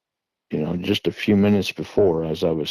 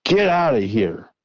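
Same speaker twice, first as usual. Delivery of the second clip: retold yelling; 3 semitones higher than usual. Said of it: "I start yelling".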